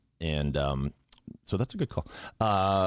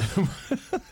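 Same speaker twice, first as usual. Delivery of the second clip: high laugh. Real, not acted. Laughs at own voice.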